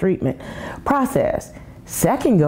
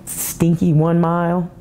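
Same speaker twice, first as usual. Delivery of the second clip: normal talking voice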